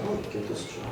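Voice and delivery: mocking whisper; sneering aside about the kids. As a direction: whisper it under your breath.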